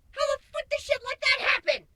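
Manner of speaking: stunned disbelief. Acted. Shapeshifter gawking at the narrator in disbelief. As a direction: squeak out your disbelief.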